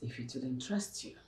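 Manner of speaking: ordinary delivery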